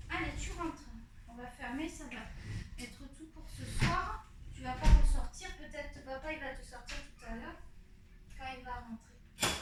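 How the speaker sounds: soft coaxing voice to a pet; talking to the dog. Real, not acted. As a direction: gently coax the dog inside.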